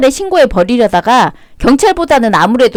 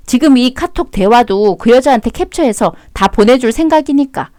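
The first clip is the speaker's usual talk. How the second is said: normal talking voice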